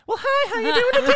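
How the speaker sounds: very high, loud, sugary greeting; put-on customer voice. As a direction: gush it like an over-sweet clerk. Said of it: "kinda like a deli roulette".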